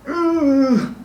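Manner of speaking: high, drawn-out "euu" of dread; mock frightened groan. Preacher reacting to a scary story, mock frightened groan. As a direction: let out a high mock shudder.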